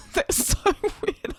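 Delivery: breathless high laugh. Real, not acted. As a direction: laugh through the words. Laughing at own hiccups.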